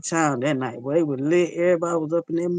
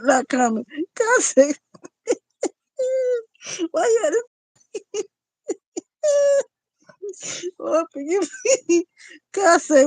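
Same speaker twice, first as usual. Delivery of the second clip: high-pitched, breaking up with laughter; real helpless laughing; 11 semitones higher, 3 dB louder than usual. Real, not acted. Host cracking up at a viewer's comment, helpless laughing. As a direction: squeal the words out between laughs. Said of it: "I wasn't trying to laugh".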